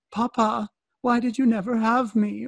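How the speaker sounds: put-on high pleading little-girl voice, up an octave; child's voice. Little girl pleading with her dad in a high voice. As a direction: plead like a small daughter.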